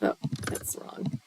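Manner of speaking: soft unvoiced correction; whispering. Real, not acted. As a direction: whisper it to yourself.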